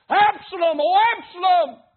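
loud, high wail of grief; anguished cry. Retold or imitated. Preacher voicing David wailing for his son, loud anguished cry. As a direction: cry his son's name out over the hills.

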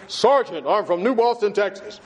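puffed-up recruit, a hint of pride; the recruit in basic training, mildly puffed up; mock manly bass. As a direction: throw your chest into it, lightly. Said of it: "I put up all that manhood and that bass I could throw in there".

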